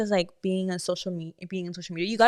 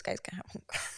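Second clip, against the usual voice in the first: whispered aside; 16 dB quieter, faster than usual. Real, not acted. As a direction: whisper it under your breath. Mumbled aside.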